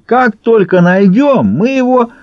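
high, slow, servile assurance; eager promise. A narrator's reading. Уйбуй promises the baron to find the missing leader, eager.

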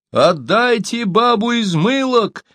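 high, slow calling out; storyteller voicing a distant shout; shouting across. A narrator's reading. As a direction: call the demand out, drawing it long. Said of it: "крикнул им Сибун".

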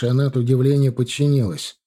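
ordinary delivery, nothing marked

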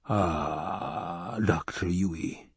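low, soft menacing Baron voice; a quiet, heavy villain's purr. A narrator's reading. Baron greets the doctor.

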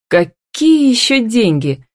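high, drawn-out astonishment; put-on surprised question. A narrator's reading. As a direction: act slowly baffled, voice lifted. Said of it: "удивилась она".

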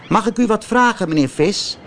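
put-on high, quick and polite little boy; a puppet boy politely addressing the fish. A narrator's reading. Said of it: "zei Pinocchio".

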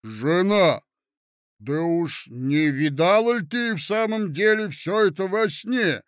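loud, weighty dull husband; ponderous husband. A narrator's reading. Husband ponderously answering his wife, loud.

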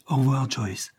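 a touch of a sigh; sighed farewell. A narrator's reading. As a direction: sigh it out gently. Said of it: "soupira Isabelle".

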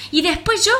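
high, loud tiny ladybug. Acted. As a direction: squeak it like a plucky ladybug. Ladybug joins the fight.

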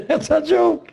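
high, laughing scoff; laughing in high-pitched disbelief; scornful laugh. Real, not acted. Laughs while scoffing at claim.

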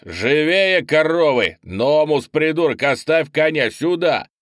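loud, high-pitched bawling at soldiers; yelling orders. A narrator's reading. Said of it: "заорал Орнис". (орёт приказы)

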